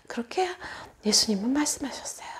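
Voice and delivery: ordinary delivery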